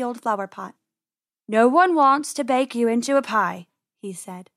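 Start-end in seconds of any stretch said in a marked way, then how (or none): 1.5-3.7 louder, put-on voice of false reassurance; coaxing farmer. A narrator's reading. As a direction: coax them out of hiding. Farmer falsely reassures hidden rabbits.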